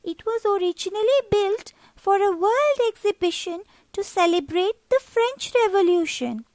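put-on a kid proudly explaining a landmark; lightly voiced schoolboy, pleased with himself; proud young boy. Pia tells about the tower.

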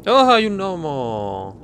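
long drawn-out, high cooing start gliding down; delighted surprise. Real, not acted. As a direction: coo slowly at a cute discovery. Delighted at finding a gnome. (sorpresa enternecida y alargada)

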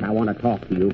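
usual speaking voice

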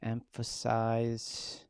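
"Emphasize" drawn out very slowly, syllable by syllable; spelling it out. Real, not acted. Host typing "Emphasize" slowly like a third grader spelling. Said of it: "like a third grader spelling".

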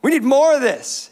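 playfully voicing an eager congregation; mock eager cry. Pastor mimicking an eager crowd, a mock excited cheer.

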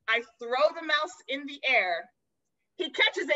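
ordinary delivery

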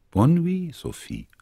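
lightly voiced grandma, high and bright on the first words; a gentle granny bidding goodnight; grandma's goodnight. A narrator's reading. Grandma wishing Sophie goodnight, a lightly voiced high granny.

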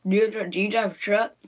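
ordinary talk

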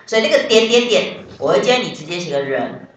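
ordinary delivery

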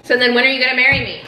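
fast pushy question; mood swings. Acted. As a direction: rush it out. Abrupt fast demand.

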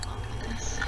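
soft whisper. Acted. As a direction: breathe the word. Reading a sad chat line.

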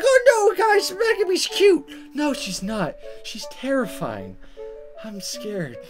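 ordinary delivery

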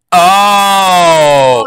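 suddenly catching on; dawning realization. Retold or imitated. Retells sudden realisation about Storm's name.

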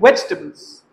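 वेजिटेबल्स in three beats, "VEJ-tuh-bulz"; no "i" after the j of वेजिटेबल्स; reduced vegetables pronunciation. Shown as a demonstration. Teacher checking students follow, वेजिटेबल्स as "VEJ-tuh-bulz". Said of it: "वेज ही नहीं हैगा".

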